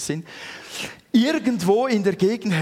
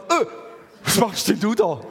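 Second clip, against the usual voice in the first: her surprised question, higher and louder; surprised wife; 4 semitones higher, 3 dB louder than usual. Retold or imitated. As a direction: catch him in the act, a surprised wife. (überraschte Ehefrau, höher und lauter)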